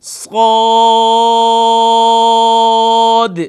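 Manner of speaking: صاد held long, ending on a bouncing dal; long madd with qalqala. Shown as a demonstration. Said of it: "دال پر قلقلہ بھی ہوگا".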